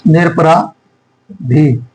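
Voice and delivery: निरपराधी slowly, long pause before the final "dhi"; syllable-by-syllable dictation. Shown as a demonstration. Dictating, word broken into parts.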